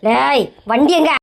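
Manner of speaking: slow, low, threatening question; low menacing. Acted. Wife darkly demands where the scooter is, low and slow. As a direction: drop the voice and growl it out. Wife suddenly suspicious of husband.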